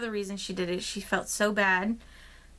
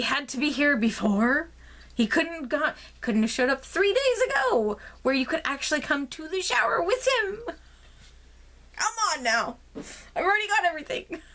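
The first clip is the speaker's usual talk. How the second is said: much higher, rising, incredulous complaint; exasperated rant; 7 semitones higher than usual. Real, not acted. Ranting about baby's early timing.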